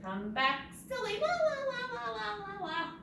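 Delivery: high, goofy babble; playful high-pitched nonsense; real silly. Shown as a demonstration. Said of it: "You gotta be real silly".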